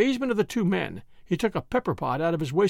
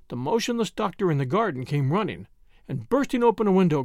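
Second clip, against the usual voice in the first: usual speaking voice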